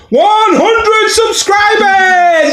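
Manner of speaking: high, drawn-out triumphant shout; big high-pitched celebratory announcement. Real, not acted. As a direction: shout the milestone out slowly and proudly. Announcing a channel milestone.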